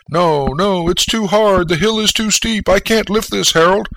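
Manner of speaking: high mock whimper, not truly crying; a touch of fake weeping, high and pleading. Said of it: "And you weep".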